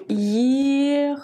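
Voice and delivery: Ехать stretched over long, level syllables; sounding out slowly. Shown as a demonstration. Teacher writing the verb first, drawn-out syllables. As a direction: draw out each syllable as you write. Je l'écris tout d'abord.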